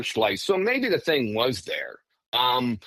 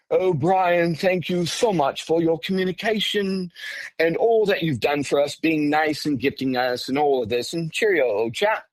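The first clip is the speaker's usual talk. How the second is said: higher-pitched, joking Englishman impression; mock English accent; 4 semitones higher than usual. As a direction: put on a chatty English gent. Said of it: "it wasn't some Englishman talking".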